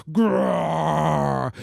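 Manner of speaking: growling like a grizzly; a playful bear growl. Acted. Storyteller to audience, hammed-up grizzly growl.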